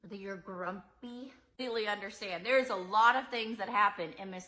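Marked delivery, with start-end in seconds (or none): none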